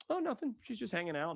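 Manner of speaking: high-pitched, breezy imitation of innocence; mock nonchalant. Contestant brushing off prying producers, mock nonchalant.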